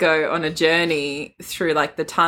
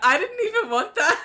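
high voice breaking into laughter; laughing mock voice; 12 semitones higher than usual. Friend voicing the Bone Carver while cracking up, laughing. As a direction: laugh through a mock complaint.

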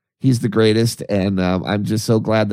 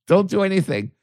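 higher, rushed, excited pleading; eager plea; 9 semitones higher than usual. Retold or imitated. Writing to David, begging him to hold the drum. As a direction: beg him not to sell, all excited. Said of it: "I'm like".

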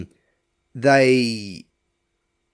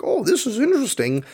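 naive interest; 11 semitones higher than usual. Retold or imitated. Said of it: "you'd probably be like".

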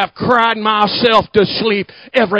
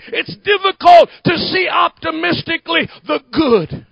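loud, building sermon cry; full-force preaching; 8 semitones higher, 4 dB louder than usual. Acted. Pastor pressing the congregation about their wounds, loud preaching. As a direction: preach it hard and loud, rising.

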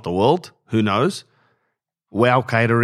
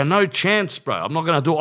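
much higher, retold fired-up refusal; pumped up; 8 semitones higher than usual. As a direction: sound hyped and dead set. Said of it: "I got pumped up".

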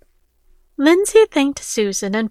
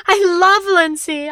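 much higher desperate shout; raging cry; 8 semitones higher, 3 dB louder than usual. Acted. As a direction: scream out your love in fury. Victor beating Rick.

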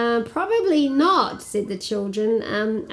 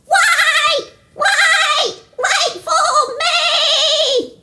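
high calling voice, much higher; 4 dB louder, much slower than usual. Acted. A little character calls out to catch up. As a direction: call after them from far behind.

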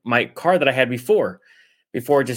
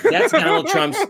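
laughing. Real, not acted. Cracking up at own joke.

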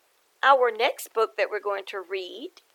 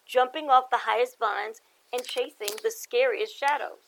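lively picture-book read-aloud; story narration; 5 dB louder than usual. A narrator's reading. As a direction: read it to the kids with bounce.

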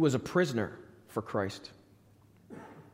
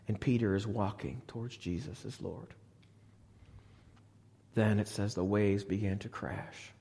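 ordinary talk

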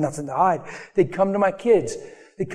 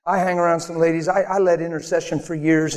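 ordinary talk, nothing marked

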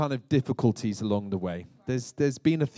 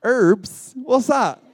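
high, puzzled query with "Herbs" as urbs; mock bafflement; 9 semitones higher, 6 dB louder, much slower than usual. Brit baffled by a guest's request, high mock bafflement. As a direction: play baffled by a dropped h, pitched up.

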